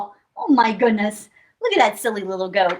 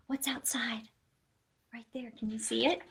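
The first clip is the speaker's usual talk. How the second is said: hushed, breathless question; suspense whisper; 15 dB quieter than usual. Acted.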